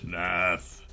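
soft, hushed creature voice; grave cursed king. Acted. Cursed king addresses the hero, soft grave voice. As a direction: let the name drop softly.

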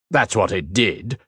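usual speaking voice

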